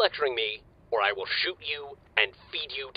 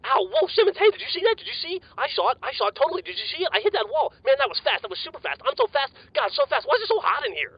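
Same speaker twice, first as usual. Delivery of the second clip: much higher, tumbling words; buzzing from a speed boost; hyper and wired. Acted. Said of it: "Griff, calm down".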